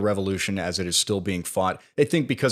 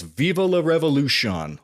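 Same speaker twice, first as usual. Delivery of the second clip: higher-pitched imitation of a rallying cry; half-yelled slogan, pitched up; mild mock yell; 7 semitones higher, 3 dB louder than usual. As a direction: call it out like a retold yell, only slightly louder. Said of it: "he yells".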